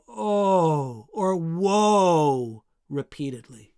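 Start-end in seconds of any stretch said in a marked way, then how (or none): 0.1-1.1 long, falling mournful moan; mournful cry. Shown as a demonstration. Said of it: "It's like crying". 1.4-2.6 drawn-out, sinking "Woe"; mournful cry. Shown as a demonstration. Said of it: "It's like crying".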